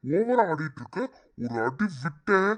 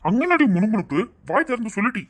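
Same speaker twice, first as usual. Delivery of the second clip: raised, high scolding voice; angry demand; 6 semitones higher, 4 dB louder than usual. Acted. Husband ordering his muttering wife to speak up, angry. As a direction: snap at her, loud and high.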